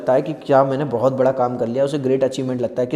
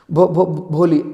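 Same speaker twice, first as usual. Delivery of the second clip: louder, stuck on bho before the name; stammering; 5 dB louder, slower than usual. Acted. Shy girl finally stammering out her name. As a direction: push out the name through a stammer. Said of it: "हकलाते हकलाते".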